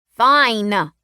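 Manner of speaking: put-on loud sharp snap from a boy; snapped. A narrator's reading. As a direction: bite it off, loud. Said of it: "Drake snapped".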